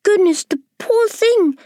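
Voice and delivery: high pitying ladybird wail; ladybird crying out. Acted. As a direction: cry out in pity. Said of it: "the ladybird cried".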